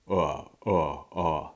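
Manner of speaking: uh, uh, uh, low in the chest; low chest vowel. Shown as a demonstration. Teacher demonstrating the areya as a low chest uh. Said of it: "kind of in the chest sound".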